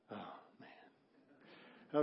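soft low whispered oh man; whispered dismay. Retold or imitated. Wife to preacher, a whispered oh man. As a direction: breathe it out in dismay. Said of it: "she's looking at me like".

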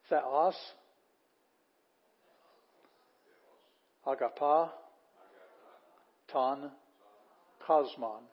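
word by word, each one set apart; slow, deliberate Greek read-aloud; teacher modelling Greek words, long pause after each. Shown as a demonstration.

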